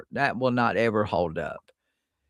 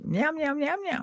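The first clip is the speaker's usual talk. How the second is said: high mewing cat voice; cat meow; 4 dB quieter than usual. Pretend. Playful meow to a friend about kittens. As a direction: mew like a little kitten.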